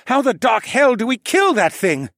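high, loud exasperated outburst; alarmed shouted question. A narrator's reading. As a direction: demand it at a shout mid-battle. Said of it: "the Falcon Man asked me".

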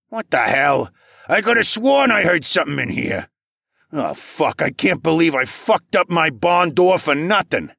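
high-pitched, exasperated farmer voice; irate farmer grumbling at high pitch; angry farmer. A narrator's reading. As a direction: curse like a farmer fed up with himself. Angry farmer searching his barn, cursing.

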